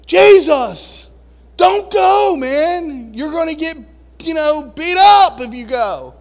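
high, loud urgent plea; pleading. Retold or imitated. Peter begging Jesus not to go, loud high plea. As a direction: beg him not to go.

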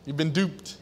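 ordinary talk

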